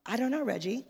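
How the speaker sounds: airy, pointed mock indifference; sarcastic breezy reply to husband; petty mock nonchalance. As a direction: play it cool to needle him. She answers husband with mock indifference.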